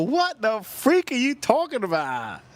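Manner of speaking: high, slow, stretched-out incredulity; retold disbelief. Reacting to friend's eaten pet, incredulous. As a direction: imitate stunned disbelief, pitch way up.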